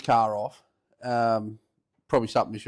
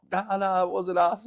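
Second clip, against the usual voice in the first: higher-pitched mock denial; 8 semitones higher, slower than usual. Teen denying it to the old boy, mock innocent. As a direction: plead innocence in a raised pitch. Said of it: "trying to do the old denial thing".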